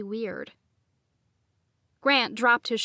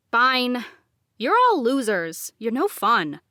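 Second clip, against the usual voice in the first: sulky; 6 dB louder than usual. A narrator's reading. Grant sulking, gives up.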